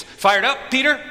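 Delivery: high, loud rousing question; rallying call. Acted. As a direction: call out to fire up a follower. Jesus rallying disciples, acted out.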